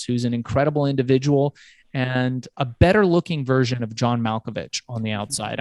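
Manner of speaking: ordinary talk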